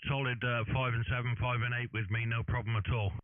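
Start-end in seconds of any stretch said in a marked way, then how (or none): none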